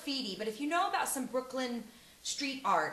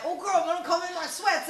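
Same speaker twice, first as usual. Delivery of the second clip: higher, louder acted-out quip; sassy self-parody; 6 semitones higher, 6 dB louder than usual. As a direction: play up the sweats-wearing gym misfit. Acts out herself at gym.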